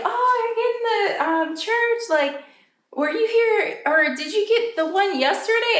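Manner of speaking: high, sing-song mock employee voice; chirpy store employee. Employee spotting the church in the cart, high and chirpy. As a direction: bounce it up like a cheerful clerk.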